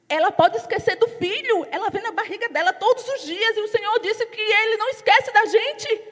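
fervent preaching. Acted. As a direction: preach it, astonished at God's love. Preaching climax to congregation.

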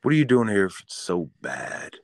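ordinary delivery

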